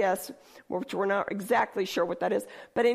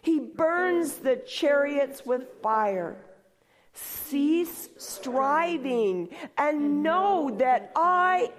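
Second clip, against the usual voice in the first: slow, measured reading of a psalm; solemn scripture reading; slower than usual. Acted. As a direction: read it out slowly like holy scripture.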